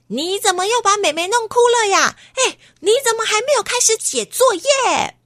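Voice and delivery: high, fast scolding mother; scolding mom. Acted. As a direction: nag like an annoyed mom, quick and high. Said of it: "妈妈就又说". (高声快速责骂的妈妈)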